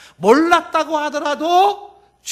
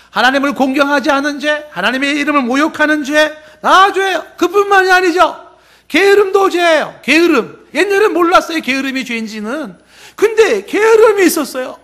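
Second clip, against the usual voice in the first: loud, raised preaching voice; forceful preaching; 4 semitones higher, 4 dB louder than usual. Real, not acted. Preacher lists forgotten sins to congregation, forceful preaching. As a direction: drive each point home to the congregation.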